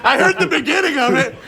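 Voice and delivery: high shouting through laughter; excited laughing shouts. Real, not acted. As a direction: protest loudly, laughing. Excited protest during game.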